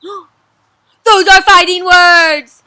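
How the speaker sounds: very loud, slow dramatic cry; mock outrage. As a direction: ham up the offended warrior. Reacting to Paper's greeting.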